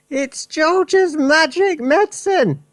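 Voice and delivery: put-on high, loud old woman's cry; triumphant Grandma, high and loud; Grandma shouting. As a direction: crow it down from the rooftop at Mary. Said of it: "Grandma shouted".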